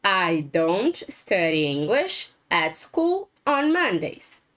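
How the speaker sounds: slow, careful repeat of the example; slow repeated model. Shown as a demonstration. Teacher repeating example for students, slow and clear. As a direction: say it again slowly for the class.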